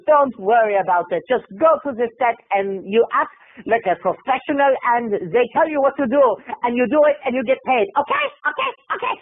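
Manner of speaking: brisk mock German agent; German diva. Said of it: "the German diva".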